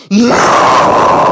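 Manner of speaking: bear's growl. Retold or imitated. The bear answers the camper's yell, a mock growl echo. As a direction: growl it back like the bear repeating you. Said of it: "the bear five seconds later going".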